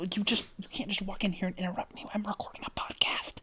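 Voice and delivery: annoyed hushed whisper. Real, not acted. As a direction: hiss it at the cat under your breath. Scolding cat while recording podcast.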